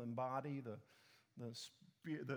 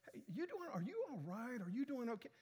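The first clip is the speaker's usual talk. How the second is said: much higher, mock concerned friends; imitating friends' concern; 6 semitones higher than usual. Friends check on the tired pastor, higher mock concern. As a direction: ask it like a worried friend, up high. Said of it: "Chris and others are coming".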